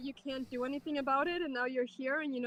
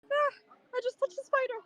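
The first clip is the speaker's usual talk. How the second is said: high, loud startled shrieks; real fright, shrieking at a spider; screaming at a spider; 11 semitones higher, 4 dB louder than usual. Real, not acted. Spider surprise, touched it.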